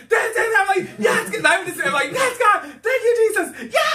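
high shouted thanks, nearly breaking; shouting praise. Retold or imitated. Preteen overcome at gospel show, shouting high. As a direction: shout it to the heavens. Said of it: "When I got up".

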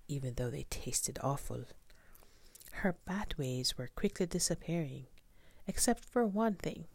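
usual speaking voice

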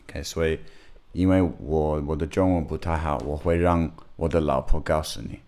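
Mandarin with a foreign accent; non-native speaker talking Chinese; foreigner speaking mandarin. Real, not acted.

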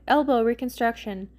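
plain reading voice

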